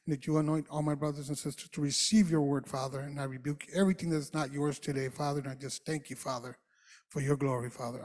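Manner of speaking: low, soft, murmured prayer. Acted. Pastor prays over brothers and sisters, quiet low prayer. Opening prayer before the sermon.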